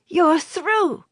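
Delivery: put-on high, bright child voice; excited child. A narrator's reading. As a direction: cheer like a delighted boy. Child greets man through wall.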